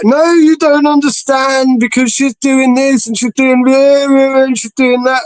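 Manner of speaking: high, slow, wailing imitation; hammed-up whinge about his girlfriend; whiny mock complaint.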